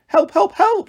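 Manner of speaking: very high, repeated yelping; high-pitched sheep panic; shouted cry for help. A narrator's reading. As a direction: squeal for help three times. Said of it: "shouted Dolly three".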